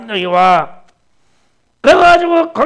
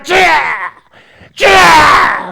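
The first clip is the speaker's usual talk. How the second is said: louder shouted cries of the Lord's name; crying out Lord; 2 semitones higher, 5 dB louder, much slower than usual. Retold or imitated. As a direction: call out like a man gone wild in prayer. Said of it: "미쳐가지고". (미친 듯이 외치는 "주야")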